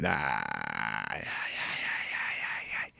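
soft, near-voiceless string of ays; barely audible mock groans; faint pained ays. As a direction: let the ays leak out as if winded. Reacting to the caller's insult.

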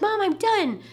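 put-on high, loud child's call from the bathroom. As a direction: imitate a little kid calling Mom. Retold child calling from bathroom.

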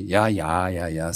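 low-pitched, weary yeah-yeah; deep, offhand placating; dismissive. A narrator's reading. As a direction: brush off a fussy correction. Fireman brushes off her correction.

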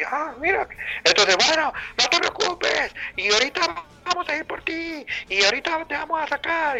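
voz aguda y lenta que tranquiliza; amigo español tranquilizando. Retold or imitated. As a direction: imita al amigo que promete rescatarlo. Amigo tranquiliza desde arriba.

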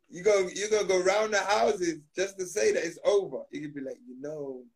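ordinary delivery

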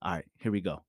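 hushed, half-whispered pep talk to himself; soft inner voice, quiet and low; calm self-talk. Shown as a demonstration. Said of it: "I speak this way, then I go".

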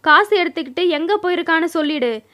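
plain reading voice

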